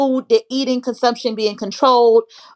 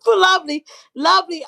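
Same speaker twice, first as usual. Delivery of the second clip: laughing; 7 semitones higher than usual. Real, not acted. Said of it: "That's why I was laughing like that".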